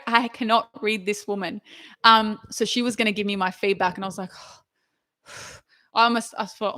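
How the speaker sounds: normal talking voice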